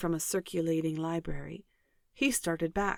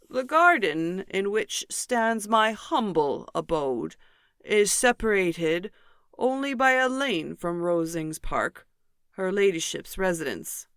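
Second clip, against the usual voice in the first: pompous Mr Collins; 5 dB louder than usual. Acted. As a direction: puff up each word like a self-important parson. Mr Collins describes his patron.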